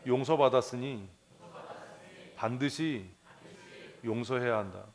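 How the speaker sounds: loud, deliberate declaration for the congregation; leading a recitation. Shown as a demonstration. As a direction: say it as a creed together. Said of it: "한번 하십시다". (다 같이 따라 외치듯 선포)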